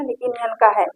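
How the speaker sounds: normal talking voice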